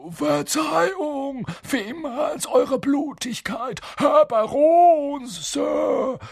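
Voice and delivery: high, drawn-out grovelling; oily, servile and slow apology; slimy. A narrator's reading. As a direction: fawn obsequiously in a high poltergeist voice. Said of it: "sagte er schleimig".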